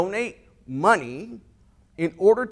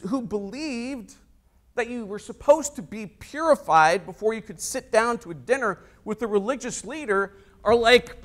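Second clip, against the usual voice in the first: usual speaking voice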